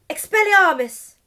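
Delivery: loud high spell cry; spell shout. Acted. Albus duelling Delphi, shouting the disarming spell.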